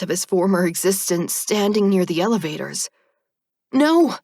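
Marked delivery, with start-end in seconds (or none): 3.7-4.2 sudden loud panicked cry; panicked yell. A narrator's reading. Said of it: "I yell in panic".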